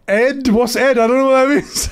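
high, baffled mimicry; mock confused voice. Imitating Triple H baffled by the Discord. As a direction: act utterly lost, half laughing. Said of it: "It was like".

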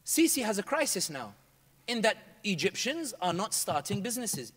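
ordinary delivery

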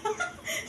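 quick high-pitched giggle; a real pleased giggle. Real, not acted. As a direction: giggle up high at your own outfit. Giggle while showing off outfit.

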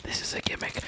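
hushed, voiceless aside; confidential whisper. Acted. Streamer admitting the gimmick to chat, confidential whisper. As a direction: whisper it like a secret admission. Whispered confession between hype lines.

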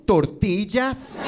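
"tortillas" drawn out with exaggerated Spanish sounds; overpronounced Spanish. Friend trying to connect over lunch, overpronounced "tortillas". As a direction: overdo the Spanish on "tortillas", stretching it. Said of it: "they love to overpronounce words".

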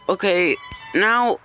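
ordinary talk

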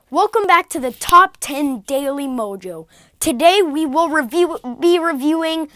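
loud, upbeat countdown host read; announcer intro. Acted. Host retaking the intro in announcer voice. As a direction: restart the show's opening line with host energy.

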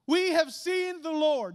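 high, loud and slow, full of wonder; excited. Shown as a demonstration. Preacher showing disciples telling Thomas, excited. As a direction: read it with the exclamation mark. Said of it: "There's an exclamation mark there".

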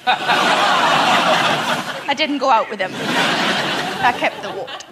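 slow words broken by laughter; giggling through the punchline; laughing. Real, not acted. Comic giggling at her own joke to the audience.

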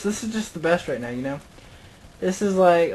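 ordinary talk, nothing marked

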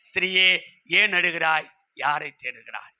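low, gentle, falling away softly; tender question. Acted. Same question, now gently to listeners. (கனிவாக, தாழ்ந்த குரலில் கேட்பது)

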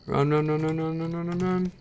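rising panicked run chant. Real, not acted. Chased by raccoon.

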